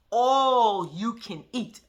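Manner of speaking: mock the careful pronunciation, word by word; slow exaggerated pronunciation. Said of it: "you was gonna pronounce it".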